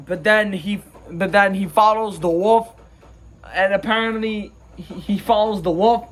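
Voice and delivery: ordinary talk, nothing marked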